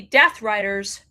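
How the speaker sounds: normal talking voice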